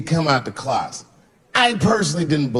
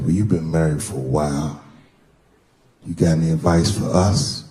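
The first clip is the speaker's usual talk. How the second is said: lower, lightly voiced friend; friend's voice; 10 semitones lower than usual. Buddy admits nerves to Dave, lower voice. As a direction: drop low for the nervous buddy.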